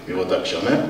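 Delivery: usual speaking voice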